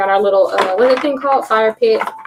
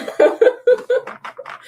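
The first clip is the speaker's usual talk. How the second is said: high squealing laugh. Real, not acted. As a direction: burst into a high giggle. Laughing at viewer's comment.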